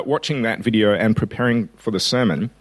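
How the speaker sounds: ordinary talk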